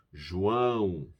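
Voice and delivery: João, ão through the nose; nasal Portuguese João. Shown as a demonstration. Teacher repeating the nasal João for the class. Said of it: "Sale como se fuera por lá nariz".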